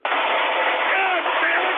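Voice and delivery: a slow, stretched-out howl of rage; drawn-out anguished cry. Acted. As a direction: wail it out in frustration. Host enraged at Alex Jones.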